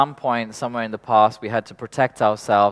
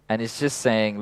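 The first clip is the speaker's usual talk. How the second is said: normal talking voice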